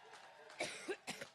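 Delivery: deep hacking cough from the chest; chesty cough. Real, not acted. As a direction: cough it out hard. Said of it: "my allergic reaction".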